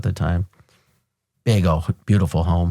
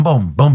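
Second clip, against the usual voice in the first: higher, louder spoken booms; gunshot sounds; 6 semitones higher, 5 dB louder than usual. Retold or imitated. Billy Bob firing from the door, loud mock gunfire booms. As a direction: mimic the shooter's rounds. Imitating return fire.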